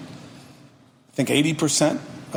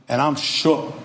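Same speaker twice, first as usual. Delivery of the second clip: usual speaking voice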